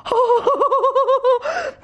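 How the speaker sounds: a high, scornful burst of laughter; mocking laugh. Natalya laughing off Ivan's offer to give her meadows, scornful laugh. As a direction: laugh him off, amused and dismissive.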